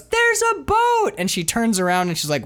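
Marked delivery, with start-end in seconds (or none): 0.0-1.1 very high, stretched mock scream; skis girl's warning. Host as the girl behind warning the driver, mock scream. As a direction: squeal out the warning, long and high. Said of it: "the girl behind goes".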